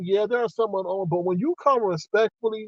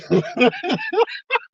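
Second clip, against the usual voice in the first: a burst of high relieved laughter; high delighted laugh; 14 semitones higher than usual. Real, not acted. Laughing with relief at being let off, high laugh. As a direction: laugh with glee at the warning.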